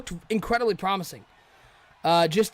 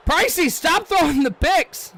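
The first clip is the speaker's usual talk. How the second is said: high, loud, drawn-out exasperated shout; frustrated yell; 10 semitones higher, 6 dB louder, slower than usual. Real, not acted. Streamer yelling at the quarterback for throwing picks, loud and high. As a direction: yell it at the screen in despair.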